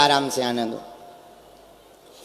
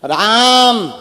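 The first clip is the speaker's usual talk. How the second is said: one long, raised cry; devotional call; 6 semitones higher, 6 dB louder, much slower than usual. Real, not acted. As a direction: call it out high, holding the vowel. Calling out to the crowd.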